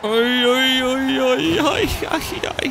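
very high, loud oi oi ai ai; squealing alarm at a crash; alarmed yelps. Real, not acted. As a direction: panic as the car hits the wall. Car heading into wall.

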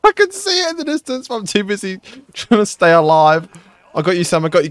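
high, loud panicked yelling; frantic yelling. Real, not acted. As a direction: yell it while fighting for life. Frantic yelling while surviving.

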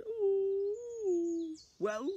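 put-on a wise old lady advising, sing-song. Old lady tells the man to swallow a spider, high voice. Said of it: "my best old lady impression".

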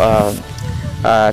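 ordinary talk, nothing marked

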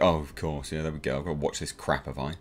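low, soft mock resignation, dripping sarcasm; sarcastic muttering. Viewer stuck in exposition dumps, sarcastic quiet grumble. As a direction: roll your eyes through it quietly. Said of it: "and you're like".

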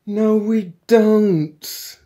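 high, loud, drawn-out contradiction; irritated exclamation. A narrator's reading. Intruder correcting his muddled partner, irritated. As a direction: stretch it out in loud exasperation. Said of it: "exclaimed Magic Thighs in irritation".

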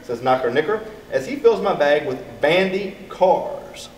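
plain reading voice

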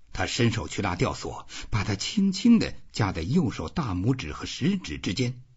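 ordinary delivery, nothing marked

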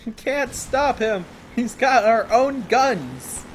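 high, slow mock panic; a hint of terrified soldier. Enemy soldiers wailing about the player, in slow mock panic. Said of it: "They're like".

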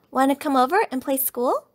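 lightly voiced eager cub; eager cub invite. A narrator's reading. Cub invites friend over to play.

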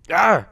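loud, high short cry; sharp burst of alarm; startled yelp. Real, not acted. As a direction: yell out at a sudden mishap. Startled while playing.

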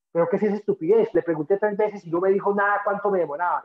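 a touch of annoyed disbelief; indignant. Retold or imitated. Traveler angry at the stonecutter.

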